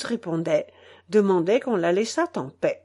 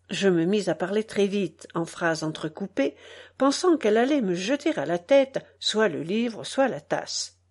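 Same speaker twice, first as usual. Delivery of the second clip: ordinary delivery, nothing marked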